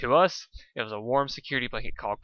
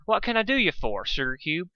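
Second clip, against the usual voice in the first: folksy cowpony; 6 semitones higher than usual. A narrator's reading. Applejack greets Dustshine.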